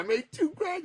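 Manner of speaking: retold giddy boast; excited announcement. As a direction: show off with the voice pitched right up.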